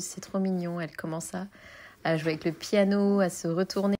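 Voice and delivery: ordinary talk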